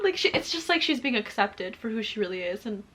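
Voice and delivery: usual speaking voice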